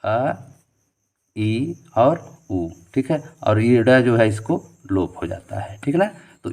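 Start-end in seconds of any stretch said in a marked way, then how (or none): 0.0-2.7 अ, इ और उ counted out very slowly; slow counting. Shown as a demonstration. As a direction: give each vowel its own slow beat. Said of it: "हम केवल गिनेंगे इसमें". (अ, इ और उ बहुत धीरे-धीरे गिनते हुए)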